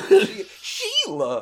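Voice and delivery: high, loud, slow laughing call of a name; excited greeting. Acted. Player greeting an old acquaintance, high, laughing and loud. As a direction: stretch the name out, thrilled and laughing.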